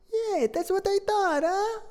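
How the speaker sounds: high, hammed-up Italian accent, slowing on the last lines; cartoon Italian, high and bouncy. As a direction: play Benvolio with a big put-on Italian accent. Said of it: "why are you speaking like that".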